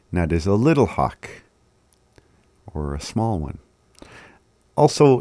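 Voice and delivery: ordinary delivery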